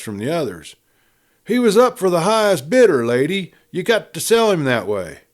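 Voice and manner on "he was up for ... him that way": raised, louder protest. Acted. A bidder protests to the lady, loud and indignant. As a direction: object loudly to the lady.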